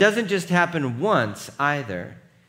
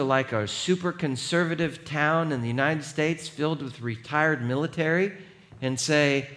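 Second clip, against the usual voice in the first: usual speaking voice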